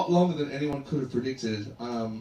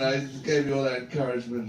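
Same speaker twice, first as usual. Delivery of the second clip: normal talking voice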